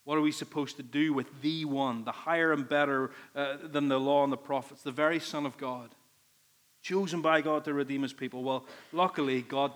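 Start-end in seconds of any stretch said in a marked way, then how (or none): none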